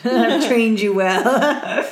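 slow, drawn-out laughing pride. Real, not acted. Host teasing her cohost, slow laughing boast. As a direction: chuckle through a smug boast. Teasing friend after right answer.

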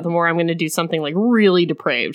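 low, husky hero voice; husky male voice. Retold or imitated. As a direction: growl it low like a possessive hero. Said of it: "he's like".

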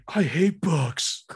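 slow, drawn-out mock complaint; hammed-up grumble, dragged out slowly; imitated one-liner. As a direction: stretch every word of the quote. Quotes a dumb one-liner, drawn out.

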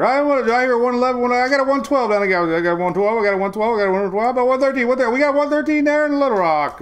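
high, loud auctioneer patter. Acted. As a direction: call bids like an auctioneer. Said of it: "This is like an auctioneer".

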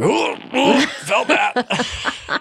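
high pained "ooh" with a laugh; pained groan. Retold or imitated. Reliving back pain.

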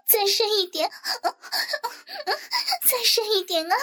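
high, gasping moans between phrases; breathless pleas rising in pitch; delirious moaning cry. Acted. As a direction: call out as if delirious, pitch climbing. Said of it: "像是梦呓一般的喊道".

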